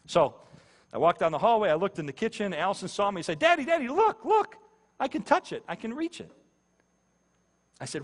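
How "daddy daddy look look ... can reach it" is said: put-on high, eager little-girl voice; excited little daughter. Little daughter calls to Daddy from the kitchen sink, excited and high. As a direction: call out high with a child's delight.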